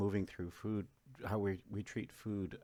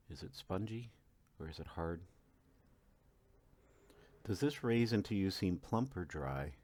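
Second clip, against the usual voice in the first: calm, unhurried meditation guide with long pauses; guided meditation. Acted. Coach guiding listeners to eat one raisin mindfully, calm meditation voice. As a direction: lead them gently through each sense.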